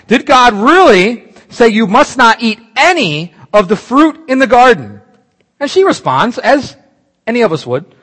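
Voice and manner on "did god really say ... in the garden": higher, louder, slow sly taunt; taunting serpent. Acted. Serpent tempting the woman in the garden, loud and sly. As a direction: dangle the question like bait. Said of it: "She buys it".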